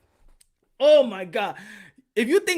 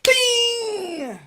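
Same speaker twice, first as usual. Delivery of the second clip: high ringing mouth sound effect; sustained comic bell sound; high, held-out ding; imitated ding; 12 semitones higher, 4 dB louder than usual. Sound effect of flying punch.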